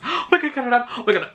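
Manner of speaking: gasping, high fangirl squeal; freaking out. Real, not acted. Fan hears her favourite city named, squealing excitedly. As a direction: lose it at the name. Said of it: "I'm, like, freaking out".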